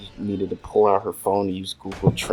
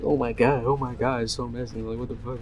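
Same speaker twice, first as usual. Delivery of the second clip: shocked; 3 semitones higher, 5 dB quieter than usual. Retold or imitated. Imitating woman's shocked reaction.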